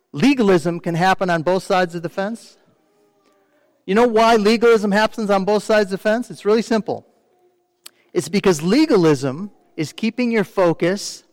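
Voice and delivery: normal talking voice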